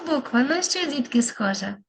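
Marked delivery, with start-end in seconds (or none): none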